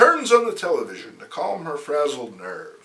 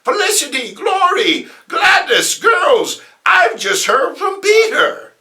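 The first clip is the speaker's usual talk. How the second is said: put-on high, loud gushing old aunt voice; excited Aunt May; 13 semitones higher, 8 dB louder than usual. Aunt May calling to the girls, high and thrilled. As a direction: burst in with news like a thrilled granny.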